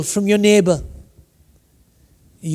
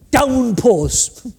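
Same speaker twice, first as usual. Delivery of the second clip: ordinary delivery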